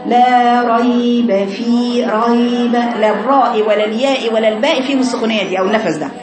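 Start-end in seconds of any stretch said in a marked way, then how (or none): 0.1-2.7 slow ريب with audible breath on the yaa; mock student breathiness in ريب; breathy wrong reading. Shown as a demonstration. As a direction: stretch it slowly letting air escape. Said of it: "شوفي السخونية".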